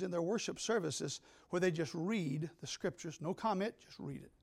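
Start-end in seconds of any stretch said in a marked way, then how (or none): 3.8-4.3 whispered. Real, not acted. Softly describing quiet reading.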